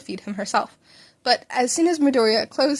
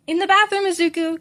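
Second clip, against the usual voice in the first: mother calls from behind a door, higher and louder; muffled reply; 7 semitones higher, 5 dB louder than usual. A narrator's reading. Mother answering her son from the bathroom, called out. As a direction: call out through the bathroom door. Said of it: "came the muffled reply".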